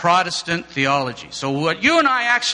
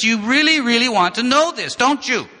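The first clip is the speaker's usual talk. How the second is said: teasing; 7 semitones higher, 3 dB louder than usual. Acted. Teasing class about dry theology.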